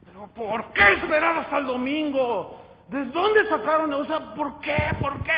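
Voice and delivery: loud, high, exasperated demanding of God; angry questioning. Retold or imitated. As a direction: ask why in an outraged cry. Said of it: "me di una enojada". (reclamo enojado y fuerte)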